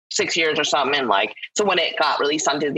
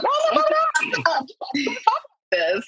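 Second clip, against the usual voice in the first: excited protest. Retold or imitated. Reassuring people she's not angry.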